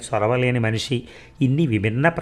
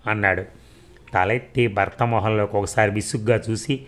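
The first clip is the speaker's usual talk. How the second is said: ordinary delivery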